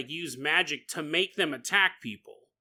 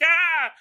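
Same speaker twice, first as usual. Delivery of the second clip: mock scream; 11 semitones higher, 8 dB louder than usual. Bug trapped under bandage screams.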